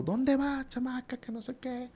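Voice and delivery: scolding grandma. Retold or imitated. Grandmother yelling at the girl at the gate, high mock scold. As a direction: squeal it like a cranky old grandma. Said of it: "me está gritando".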